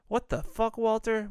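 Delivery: high-pitched, incredulous outburst; retold cry of disbelief; mock alarmed disbelief. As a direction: act out appalled shock, voice jumping up. Acted alarm at Walter's stunt.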